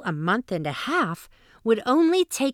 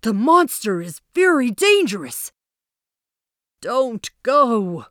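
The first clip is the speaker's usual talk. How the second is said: warning elders; 2 semitones higher, 5 dB louder than usual. A narrator's reading.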